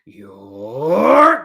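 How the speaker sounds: drawn-out rising bear yell; shouting voice. Acted. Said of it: "this voice is very loud".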